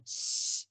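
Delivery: soft voiceless snake hiss; a quick hiss mimicking the snake. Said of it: "she's going".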